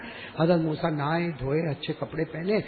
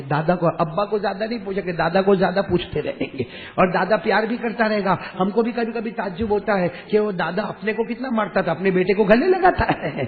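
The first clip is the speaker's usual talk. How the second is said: normal talking voice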